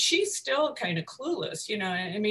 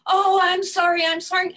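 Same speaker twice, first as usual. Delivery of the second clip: flustered apology; 10 semitones higher, 6 dB louder than usual. Retold or imitated.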